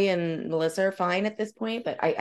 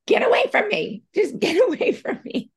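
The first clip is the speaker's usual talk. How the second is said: hammed-up shriek, half laughing; mock scream. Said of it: "you're screaming at everybody".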